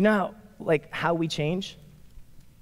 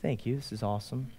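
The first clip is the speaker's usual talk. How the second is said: brief prayer; 9 semitones lower, 14 dB quieter than usual. Acted. Friend over coffee offering a brief thankful prayer, softly. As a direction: offer a small private prayer, well under your voice. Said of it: "you just offer a brief prayer".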